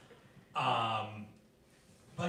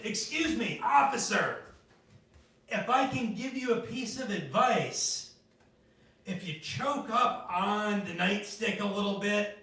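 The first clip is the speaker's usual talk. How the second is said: raised, overly courteous plea to a cop; polite mock advice to cop; 7 semitones higher, 4 dB louder than usual. Beaten suspect politely advising the cop, courteous raised voice. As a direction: beg the officer politely, voice pitched up. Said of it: "and you said".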